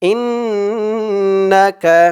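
ইন্নাকা with held nasal ইন; nasal inn. Shown as a demonstration. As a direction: hum the ন্ন of ইন্নাকা. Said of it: "ইন বলে গুণনা করতে হবে".

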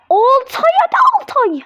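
high, loud cheering for Altay. Real, not acted. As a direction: urge him on, shouting high. Cheering on goalkeeper.

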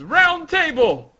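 slow, high, loud shout; grand anime battle cry; acted attack call. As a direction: draw out the move name in a big yell.

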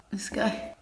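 soft breathy whisper; hushed whisper about the dog; whispered aside. Real, not acted. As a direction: whisper it to yourself.

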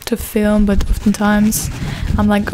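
ordinary talk, nothing marked

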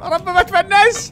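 high, tense plea; nervous gamer prayer; anxious prayer. Real, not acted. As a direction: pray you survive this jump. Praying not to die.

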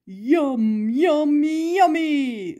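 lightly voiced, delighted Mister McGreely; yummy relish. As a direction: savour it like a hungry gardener. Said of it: "said Mister McGreely".

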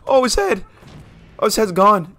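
high, loud shrieked reaction, easing down; shriek, then exclaim in shock. Real, not acted. Player seeing a head gone, high loud shock.

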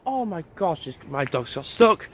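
ordinary talk, nothing marked

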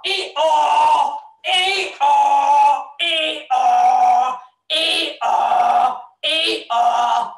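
donkey braying hee-haw; Darnell. Pretend. Host introducing the donkey, braying. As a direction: bray in two held, honking notes. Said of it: "We've got Darnell, the donkey".

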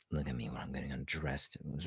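unvoiced grumble under breath; whispered mutter. Real, not acted. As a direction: mutter it to yourself. Muttering to herself, annoyed.

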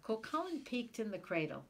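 plain reading voice

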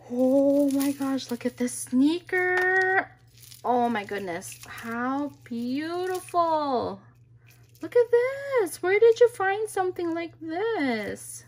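loud, high-pitched gushing; real delighted astonishment, voice soaring; thrilled amazement. Real, not acted. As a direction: squeal over an amazing find, stretching the key words. Thrilled on discovering the sneaker.

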